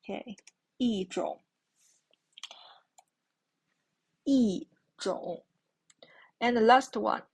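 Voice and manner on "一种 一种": 一种 as yìzhǒng, 一 falling; teacher demonstrating 一种; fourth tone yi. Shown as a demonstration. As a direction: model 一种 with a falling 一. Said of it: "the third tone".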